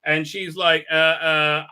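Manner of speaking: ordinary talk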